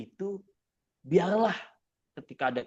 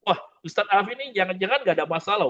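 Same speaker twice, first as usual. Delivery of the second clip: imitating congregant; 6 semitones higher, 7 dB louder, faster than usual. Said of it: "ketika Mas Adib misalnya".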